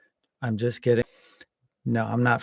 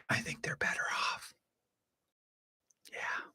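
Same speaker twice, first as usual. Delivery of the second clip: breathy whispered aside, almost to himself; 17 dB quieter than usual. Real, not acted. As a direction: drop to a whisper for a private opinion. Muttered aside after political point.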